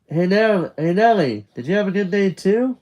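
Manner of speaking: high, loud pet-talk; talking to the dog. Acted. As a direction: chirp a question at your dog.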